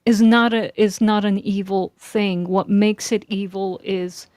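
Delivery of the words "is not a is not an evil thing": ordinary talk, nothing marked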